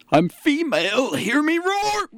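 high, rising mock roar; shrill proclamation. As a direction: belt it out like a triumphant anthem.

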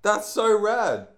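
squealing high, slow and loud; over-the-top excitement. Acted. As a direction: gush like a starstruck fan. Gushing over shirt pun.

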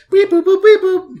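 high robotic beep boop; machine beeps. Retold or imitated. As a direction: chirp it like a computer. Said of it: "punch card".

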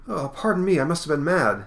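high-pitched mock apology; hammed-up contrite townsman; apologetic bystander. Dude apologizing after fight.

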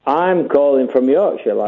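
ordinary talk

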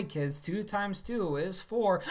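mock teacher. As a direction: spell it out slowly like a patronising teacher. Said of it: "she was like".

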